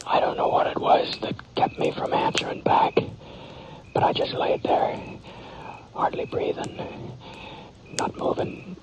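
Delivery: hushed, barely voiced telling; whispered narration. A narrator's reading. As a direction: whisper it as if afraid to be heard. Narrator feigning sleep, whispering.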